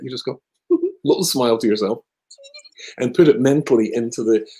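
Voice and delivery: usual speaking voice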